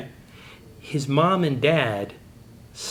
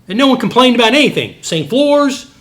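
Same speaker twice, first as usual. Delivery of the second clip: much higher and louder, driving the point home; preaching emphasis; 10 semitones higher, 8 dB louder than usual. Real, not acted. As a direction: lift it high and loud like a preacher's climax.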